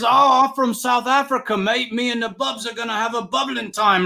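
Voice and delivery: put-on high, Aussie-sounding South African accent; hammed-up South African with a rising mate. South African fan introduces himself in a high Aussie-ish accent. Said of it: "Do your accent there".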